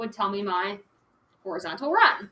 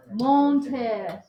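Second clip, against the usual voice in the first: teacher's playful French accent on monter; 4 dB louder than usual. Shown as a demonstration. Teacher showing off her French accent to the class. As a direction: say monter the French way, with a light flourish. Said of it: "That's my French accent".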